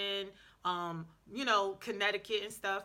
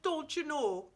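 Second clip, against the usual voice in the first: higher-pitched imitated Midwestern accent; 7 semitones higher, 3 dB louder, slower than usual. Imitating Bobby's cartoon mom, in a Midwestern accent. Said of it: "always thought Bobby's mother was Jamaican".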